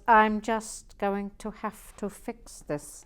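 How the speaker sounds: hammed-up imitation of his own words; mock pompous quote of himself. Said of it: "he was quoting me".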